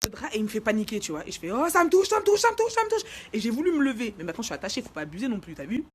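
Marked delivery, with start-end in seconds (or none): 1.5-3.0 high, loud repeated squeal; acted panic. Panicking as the sheet touches her foot, mock frantic scream. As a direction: shriek as if something horrible touches you.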